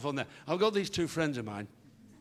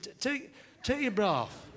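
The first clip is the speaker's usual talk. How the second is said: flustered stammer; 8 semitones higher than usual. Acted.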